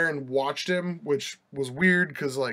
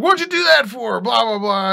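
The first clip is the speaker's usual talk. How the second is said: high, louder mock-outraged yelp; indignant protest; 8 semitones higher, 5 dB louder than usual. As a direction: squeal it like a man caught off guard. Man grabbed by clerk protests.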